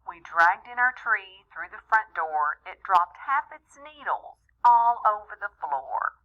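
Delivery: usual speaking voice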